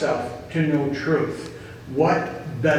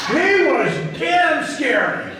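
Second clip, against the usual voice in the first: emphatic exclamation; 11 semitones higher, slower than usual. Real, not acted. Telling newcomers how frightening Thane was, emphatic shout. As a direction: belt it out, slow and high.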